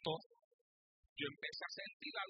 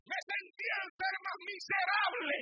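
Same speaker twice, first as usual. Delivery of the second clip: loud anguish; 8 semitones higher, 6 dB louder, slower than usual. Real, not acted.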